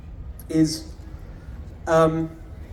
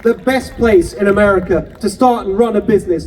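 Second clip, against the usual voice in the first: louder, punchy campaign oratory; rousing rally speech; 6 dB louder than usual. Acted. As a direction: drive every promise home to the crowd. Rally speech climax at full force.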